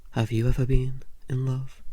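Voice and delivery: ordinary delivery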